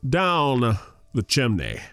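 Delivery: ordinary delivery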